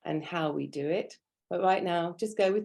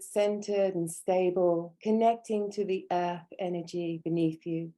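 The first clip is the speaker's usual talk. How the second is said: measured meditation voice, long silences between instructions; guided meditation. Real, not acted. Teacher grounding students at a training's opening, guided meditation. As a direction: guide their breathing in calm, spaced phrases.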